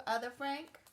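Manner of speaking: plain reading voice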